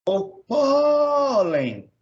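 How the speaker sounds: "pólen" called high with a very long PÓ; held stressed syllable. Shown as a demonstration. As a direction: hail "pólen" like a person, holding PÓ. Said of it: "mais o pó".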